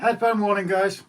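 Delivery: high, loud warning call. Real, not acted. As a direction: shout the warning to the guys. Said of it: "echoes the warning loudly".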